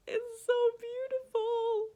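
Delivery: "It's so beautiful" squealed high and loud, drawn out; squealing delight. Real, not acted. Admiring the cover.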